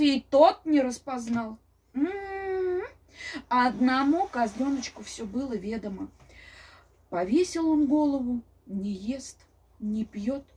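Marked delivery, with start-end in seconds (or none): none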